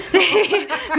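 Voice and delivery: short giggling burst; amused laugh. Real, not acted. Laughing at mum's posh voice.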